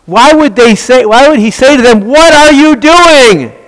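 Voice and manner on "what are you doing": slow, higher, incredulous rebuke; slow, raised mock rebuke. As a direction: ask it as if you can't believe them. Voicing Paul's rebuke.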